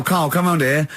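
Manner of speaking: ordinary talk, nothing marked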